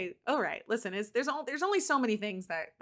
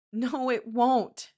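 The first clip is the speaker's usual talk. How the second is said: 1 tearful denial; 4 semitones higher, 7 dB louder, much slower than usual. A narrator's reading. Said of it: "she says".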